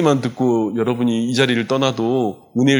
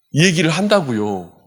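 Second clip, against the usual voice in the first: usual speaking voice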